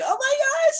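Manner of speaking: sudden high excited squeal. Retold or imitated. Retelling her joy at paying Jason, high excited squeal. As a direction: squeal it out like you can't believe it. Retold joy at first thousand.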